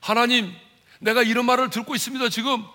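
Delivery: high, urgent cry to God; lamenting plea. Acted. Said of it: "하나님께 나가서 탄식했겠죠".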